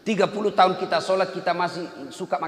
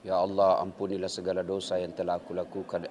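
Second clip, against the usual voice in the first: low, soft, half-hearted plea; insincere prayer; 10 semitones lower, 4 dB quieter than usual. Shown as a demonstration. Preacher mocking a shifty-eyed insincere prayer. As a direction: mumble the plea without meaning it. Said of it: "seperti matanya pencuri".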